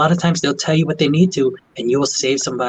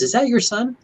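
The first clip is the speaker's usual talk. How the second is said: curious patient; 10 semitones higher than usual. Retold or imitated. Patient asks nurse.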